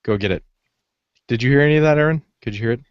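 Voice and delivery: usual speaking voice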